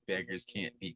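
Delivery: normal talking voice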